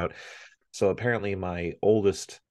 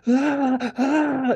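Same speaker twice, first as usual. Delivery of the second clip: very high, loud mock wail; imitated scream crying; 7 dB louder than usual. A father copies his son's scream crying from the bathroom, very high and loud. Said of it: "scream crying".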